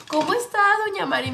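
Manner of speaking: lightly voiced chatty señora greeting; market lady. A lady greets grandma at the market, light sing-song. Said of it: "Así le decía a mi abuelita".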